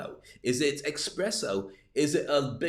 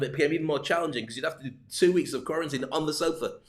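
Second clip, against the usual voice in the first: ordinary delivery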